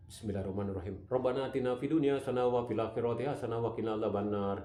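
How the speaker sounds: rattled off fast and flat, like reading a list; hurried recitation. Retold or imitated. Preacher mocking people who only read prayers, rushed. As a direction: gabble the prayer without feeling. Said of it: "dibaca dengan seperti itu".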